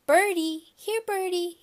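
little brother calling to pigeons; toddler calling birds. A narrator's reading. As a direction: coax the pigeons like a little kid. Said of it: "he called".

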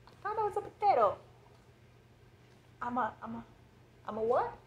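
high fast cartoon voice; squeaky confused voice. Silly acted potato/tomato dialogue.

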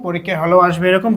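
ordinary talk, nothing marked

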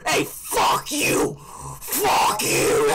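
harsh, loud, drawn-out screaming; enraged scream. Real, not acted. Streamer reacting to a caller's slur, enraged screaming. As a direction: scream over the caller in an outburst.

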